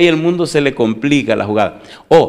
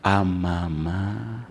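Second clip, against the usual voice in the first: lower, softer and very slow, tender; voice drops; 4 semitones lower, 6 dB quieter, much slower than usual. Shown as a demonstration. Elderly ladies speaking of their mother, low slow tender voice. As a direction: let the voice sink low and slow. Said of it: "y la voz le baja un tono".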